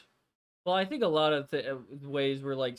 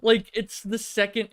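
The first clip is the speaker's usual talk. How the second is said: excited; 8 semitones higher, 9 dB louder than usual. Real, not acted. Finds the meme image.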